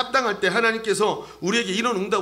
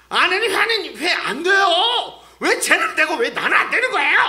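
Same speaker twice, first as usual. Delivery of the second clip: high, loud, wailing mock protest; acted indignant pleading, pitched way up; protesting complaint; 11 semitones higher, 7 dB louder than usual. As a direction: whine at God like an aggrieved child, hammed-up. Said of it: "막 이러고 따지는 게 아니라".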